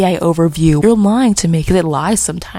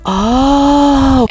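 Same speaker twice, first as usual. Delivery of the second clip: long, high "oh" of discovery; collective realisation; 6 semitones higher, 4 dB louder than usual. Retold or imitated. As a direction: draw it out as the penny drops. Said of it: "we're all like".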